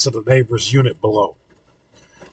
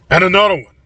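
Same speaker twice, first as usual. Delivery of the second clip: higher-pitched, contained call; mild yell; 6 semitones higher, faster than usual. A narrator's reading. Calhoun pointing at the closest door, mild yell. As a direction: call out another sighting lightly. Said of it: "Calhoun yells".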